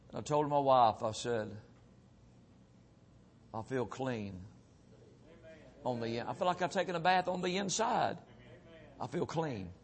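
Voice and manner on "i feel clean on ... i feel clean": hushed wonder. Retold or imitated. Telling wife after conversion.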